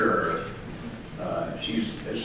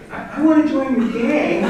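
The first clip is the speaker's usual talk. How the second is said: high, small timid voice; 5 dB louder than usual. Retold or imitated. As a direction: squeak it out like a meek little guy.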